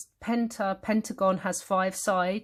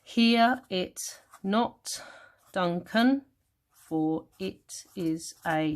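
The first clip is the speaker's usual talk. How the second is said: each syllable set apart, slowly; counting syllables; much slower than usual. Shown as a demonstration. Teacher counting ten to a line, slow separated syllables. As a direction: measure out the verse one beat at a time. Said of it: "you got your ten there".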